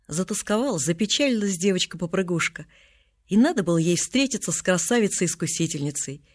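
ordinary delivery

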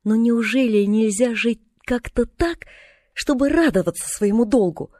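put-on slow, drawn-out girlish entreaty; pleading. A narrator's reading. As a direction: implore your aunt, stretching the words. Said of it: "с мольбой воскликнула она". (умоляюще, протяжно)

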